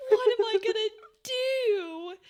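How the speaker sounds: hammed-up comic strip lament; comic book despair. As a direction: whine it like a comic book hero in crisis. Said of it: "very comic book dialogue".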